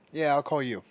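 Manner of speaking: loud reply to mom; calling out. Real, not acted. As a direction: project it across the house. Answering mother across the room.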